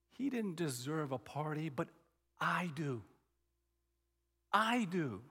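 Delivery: low, soft acted insistence; quiet resentment. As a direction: build slightly from hushed grievance to firm claim. Brother claims he deserves party.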